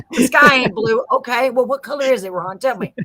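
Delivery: eager mock believer; playful gullibility. Acting out gullible listener to storyteller.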